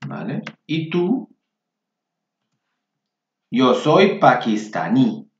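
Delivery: ordinary talk, nothing marked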